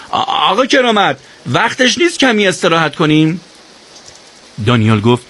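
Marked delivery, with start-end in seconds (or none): 0.1-3.4 higher call forward to the leader; half-rise and call out ahead; calling out loudly. Acted. Ali calling ahead to Keramat for a rest, loud. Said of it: "با صدای بلند گفت".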